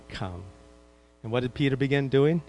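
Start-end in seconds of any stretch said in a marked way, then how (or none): 0.1-0.4 soft command. Retold or imitated. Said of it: "Jesus said what".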